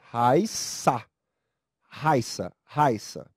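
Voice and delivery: Raiça in two syllables, ai glided; diphthong pronunciation. Shown as a demonstration. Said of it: "São duas sílabas".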